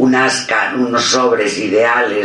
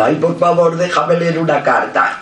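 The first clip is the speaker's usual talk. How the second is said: higher-pitched begging; pleading; 6 semitones higher than usual. Retold or imitated. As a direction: coax for a favour you were refused. Retells begging to read a letter. (súplica insistente)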